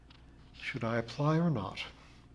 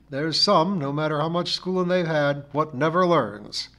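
louder, raised parting shot; triumphant char lady; 5 semitones higher, 7 dB louder than usual. A narrator's reading. Missus Pringle fires a parting shot from the gate, triumphant. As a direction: deliver the last word in triumph.

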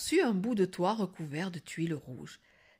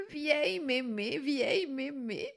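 sweetly savouring; 6 semitones higher than usual. Acted. Grandmother savours the girl's insult, cooing sweetly. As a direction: repeat it dreamily, as if charmed.

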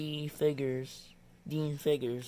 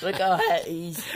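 laughing; 4 semitones higher, 8 dB louder than usual. Real, not acted. Laughing at a card photo.

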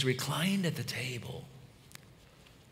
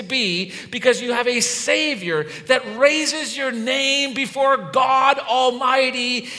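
high, loud pulpit delivery; impassioned preaching; 11 semitones higher, 10 dB louder than usual. Real, not acted. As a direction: preach it with rising force and punched words. Preaches at full force.

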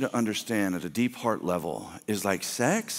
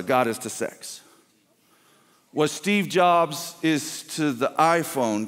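ordinary talk

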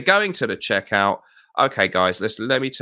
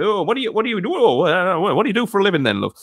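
much higher, louder matey host question; Jim Bowen; 9 semitones higher, 5 dB louder, faster than usual. Retold or imitated. Host asking a contestant her job, high and jolly. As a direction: play the chirpy northern host chatting up a contestant. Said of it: "he said to this one woman".

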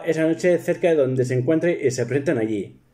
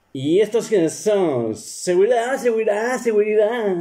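much higher, urgent call for help; calling for security; 9 semitones higher than usual. Retold or imitated. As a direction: call the guards in a put-on voice. Cayetana calls for security. (llamada agudísima a seguridad)

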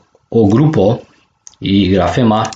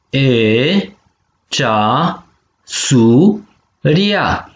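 syllable by syllable; much slower than usual. Shown as a demonstration. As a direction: model cea - su - ria with gaps. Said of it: "penem vitumen". (pe silabe: cea, su, ria)

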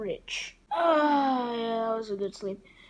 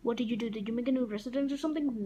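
normal talking voice

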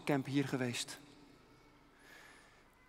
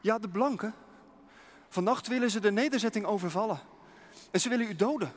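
higher and louder, fast; urgent warning; 5 semitones higher, 5 dB louder, faster than usual. Acted. Messenger warning of a night attack, urgent, higher and fast. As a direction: hurry out the bad news, higher and louder.

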